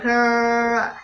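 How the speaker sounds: a throaty grrr from the uvula; uvular trill. Shown as a demonstration. Explaining the uvular R, throaty grrr. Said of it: "It's in here".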